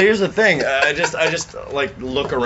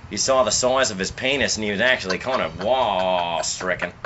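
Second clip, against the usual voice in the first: lower, laid-back Australian nature-show narrator; Australian accent; 3 semitones lower than usual. Acted. Friend narrating backyard squirrels for the podcast, in a mock Australian accent. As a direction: narrate the squirrels like an Aussie wildlife host. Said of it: "fucking Australian accent".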